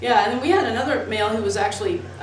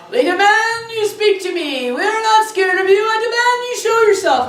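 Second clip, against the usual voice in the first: loud, high mock yell of a ghost hunter; retold demanding yell; 12 semitones higher, 7 dB louder than usual. Lady yelling at the spirit to show itself, loud high mock yell. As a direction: bellow commands at the ghost, half mocking. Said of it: "the one lady starts yelling".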